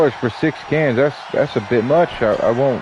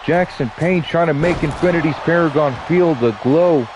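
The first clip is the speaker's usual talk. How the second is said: lively ringside announcer calling the action; play-by-play commentary; 4 dB quieter than usual. Acted. Streamer calling the wrestling match for viewers, announcer style. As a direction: call the match like a wrestling commentator, stretching the names.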